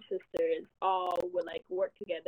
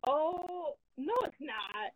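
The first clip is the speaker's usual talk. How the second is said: surprised disbelief; 7 semitones higher, much slower than usual. Retold or imitated. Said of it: "I was like".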